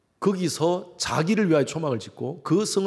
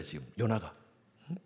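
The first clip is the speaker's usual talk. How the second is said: usual speaking voice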